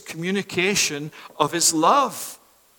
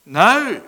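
louder punched word Now; emphatic; 2 semitones higher, 7 dB louder than usual. Real, not acted. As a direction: hit Now. hard. Urgent call to respond.

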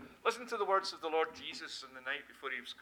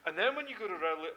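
ordinary talk, nothing marked

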